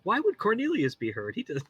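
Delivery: high-pitched mock puzzlement; lightly imitated bewilderment; imitating puzzled player. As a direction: voice her innocent question up high. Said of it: "She's just like".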